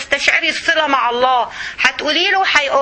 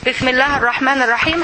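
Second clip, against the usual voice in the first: ordinary delivery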